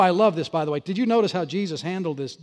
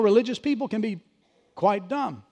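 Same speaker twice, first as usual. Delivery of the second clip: ordinary delivery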